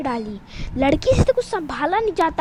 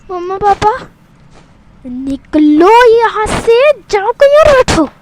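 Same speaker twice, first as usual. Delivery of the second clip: loud, higher shout; 4 semitones higher, 9 dB louder than usual. Acted. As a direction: shout them away. Sister shouting at parents.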